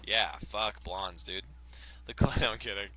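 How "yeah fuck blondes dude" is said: normal talking voice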